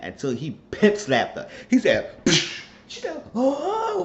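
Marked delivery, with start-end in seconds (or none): 2.2-2.6 loud, sharp "psh" slap effect; sudden vocal smack; slap noise. Retold or imitated. Imitates the sound of the slap. 3.3-4.0 high imitated "oh" of impact; sudden mock yelp; slapped cry. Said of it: "with the whole force".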